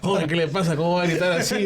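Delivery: high-pitched indignant retold protest; startled scolding. Startled attendant telling off the shouting customer, indignant scolding.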